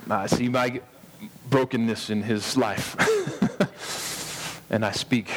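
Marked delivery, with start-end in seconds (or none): 3.0-4.6 high breath-filled laugh; amused laugh at your own remark. Real, not acted.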